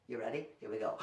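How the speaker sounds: hushed anticipation. Real, not acted. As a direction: whisper the countdown. Hushed setup before sound.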